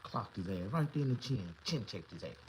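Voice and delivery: ordinary talk, nothing marked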